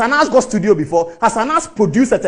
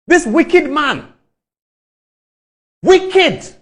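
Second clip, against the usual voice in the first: contemptuous; 5 semitones higher, much slower than usual. Real, not acted. Denouncing Anas with contempt.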